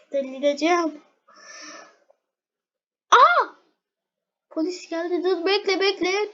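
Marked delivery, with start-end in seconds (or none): 3.1-3.5 sudden high loud "Aa"; startled yelp; surprised gasp. Real, not acted. Gamer spotting the police car, startled.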